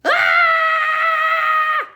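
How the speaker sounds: high, very loud long scream; shrieking scream. Acted. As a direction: scream in shock at the dog. Pigeon shrieks at dog.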